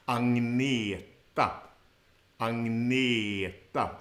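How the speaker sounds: Agneta slow, ng sound, stress on the second syllable ag-NE-ta; Swedish Agneta. Shown as a demonstration. Teacher drilling the name for learners, slow stressed demo. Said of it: "On the second syllable".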